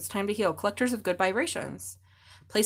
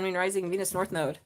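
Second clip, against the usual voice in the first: ordinary talk, nothing marked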